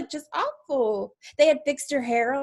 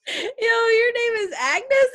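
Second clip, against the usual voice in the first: incredulous laugh; 10 semitones higher, 5 dB louder than usual. Acted. Girl laughs at witch's name.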